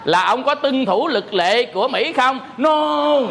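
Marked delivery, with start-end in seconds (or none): 2.6-3.2 câu "No" bật cao và to, dứt khoát; đáp "No" cao giọng như chẳng hiểu câu hỏi; mock clueless no. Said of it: "Hỏi ông có vô đây". (giả ông thầy đáp "No" với nhân viên sân bay, cao và to)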